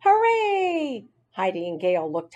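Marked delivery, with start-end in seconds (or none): 0.0-1.1 excited cheer. Acted. Characters cheering.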